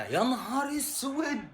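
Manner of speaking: stunned groan. Real, not acted. Fan reacting to a bad play, slow stunned groan. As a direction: let it sag out long. Watching match, stunned again.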